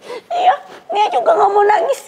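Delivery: high, slow mock wail; tearful child insisting. Daughter says she won't cry, in a drawn-out imitated wail. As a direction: whimper it like a child clutching a tissue. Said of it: "Tapi ambil pegang tisu".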